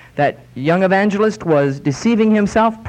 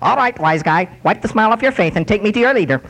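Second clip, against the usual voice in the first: a lightly voiced gruff little Martian, fast at the end; mock-tough alien bossing the piano around; growling Martian; 4 semitones higher, faster than usual. Little man from Mars growling at a piano, lightly voiced. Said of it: "and growled".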